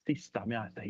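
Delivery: usual speaking voice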